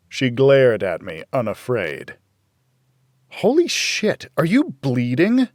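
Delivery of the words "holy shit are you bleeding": sudden high startled cry, then the question; jolted, a sharp jump up in pitch; shocked. A narrator's reading. Girl sees blood on the driver's shirt, shocked and high.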